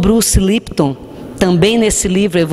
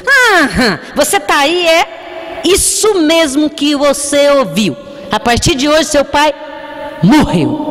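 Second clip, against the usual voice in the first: sneering mother telling her son his father is dead; mother's cruel taunt; 7 semitones higher than usual. Retold or imitated. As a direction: hammer it home like a spiteful mother. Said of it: "Virou pra ele e falou assim".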